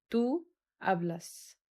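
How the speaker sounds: very slow, each syllable drawn out; slow model pronunciation. Shown as a demonstration. Teacher modelling Tú hablas for students, very slow. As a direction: spell out Tú hablas for the class slowly. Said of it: "حرف ال H silent".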